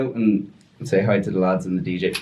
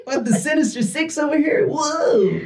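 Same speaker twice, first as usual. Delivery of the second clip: high, strained superhero cry; winded Spider-Man yelp; slower than usual. Retold or imitated. Punched in the groin, Spider-Man yelps high and strained. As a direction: yelp through the pain, staying in character.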